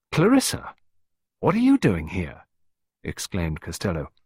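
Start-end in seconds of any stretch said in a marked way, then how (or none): 0.1-2.4 leaps high on the name, stunned; the shock of an unexpected face; surprised. Acted. Costello to Clarissa, startled, voice jumping high. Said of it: "He sounded equally surprised".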